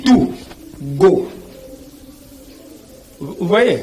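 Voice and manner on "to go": "to go" as "too", a pause, then "go"; spoken-form demo. Shown as a demonstration. Teacher contrasting the written O with what one says, spoken-form demo. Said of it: "Mais on dit".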